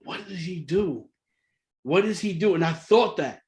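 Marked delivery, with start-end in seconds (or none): 0.0-1.1 hushed disbelief. Retold or imitated. Wondering what coach does.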